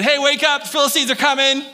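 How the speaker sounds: high, loud, quick alarm call; urgent shout. Acted. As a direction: yell him awake, faking panic. Delilah wakes Samson again.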